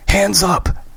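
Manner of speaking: high, sharp order; urgent command. Acted. Christy ordering Graham at the tree, urgent. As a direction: bark the command.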